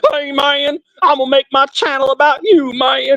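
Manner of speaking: high-pitched, hammed-up hillbilly twang; mocking hillbilly accent. Man mimicking a former friend's threat about his channel, mocking hillbilly accent. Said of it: "make fun of his accent".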